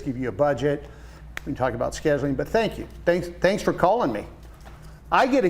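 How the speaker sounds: ordinary delivery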